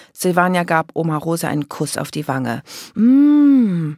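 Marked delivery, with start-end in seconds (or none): 2.9-3.9 loud, high, long hum; swooping sniff-and-savour hum; appreciative "mmm". A narrator's reading. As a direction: hum with exaggerated relish. Silvania savours grandmother's smell.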